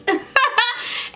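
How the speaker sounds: high burst of giggles; laugh. Real, not acted. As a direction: giggle at your own prank. Amused by the fake name prank.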